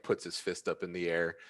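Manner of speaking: ordinary talk, nothing marked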